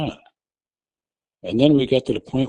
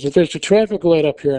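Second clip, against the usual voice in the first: higher, louder warning; retold yelling; 6 semitones higher, 4 dB louder than usual. Wife yelling at her husband about the light, retold yell. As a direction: call the light out to him. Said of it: "I'm yelling at him".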